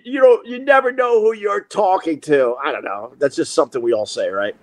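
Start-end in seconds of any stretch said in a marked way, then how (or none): 0.1-2.6 high-pitched blustering warning; mock threat. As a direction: re-enact a raised-voice empty threat. Retold threat, then repeated.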